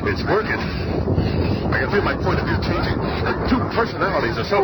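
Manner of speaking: changing voice. Acted. Ben feels the serum changing him, voice rising in pitch. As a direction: let the voice climb as the serum takes hold. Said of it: "Your voice".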